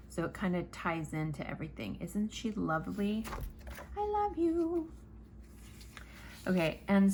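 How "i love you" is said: high, doting little voice; cutesy affection. Real, not acted. Crafter gushes over her colorful creation in a high coo. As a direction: coo it at the thing you made. Crafter admires finished piece.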